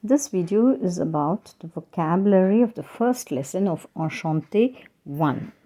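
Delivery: normal talking voice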